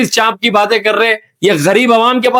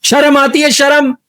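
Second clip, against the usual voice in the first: raised-pitch, insistent questioning; indignant scolding; 5 semitones higher than usual. Acted. Interviewer shames the guest for his talk, high indignant repeats. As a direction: scold him, pushing शर्म up each time.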